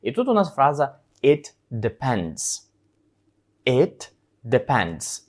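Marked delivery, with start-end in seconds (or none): 1.2-2.6 slow, clear English model for repetition; slow model phrase. Shown as a demonstration. Teacher models the phrase slowly for students. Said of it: "Спробуйте самостійно сказати". 3.7-5.2 slow, clear English model for repetition; slow model phrase. Shown as a demonstration. Teacher models the phrase slowly for students. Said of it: "Спробуйте самостійно сказати".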